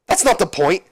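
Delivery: high, protesting comeback; indignant retort. Retold or imitated. Angle arguing back at Benoit, mock indignant.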